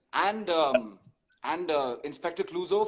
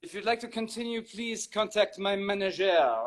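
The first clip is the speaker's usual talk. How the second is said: Inspector Clouseau; 6 dB louder than usual. Pretend. Answering the host's praise in character, French detective voice.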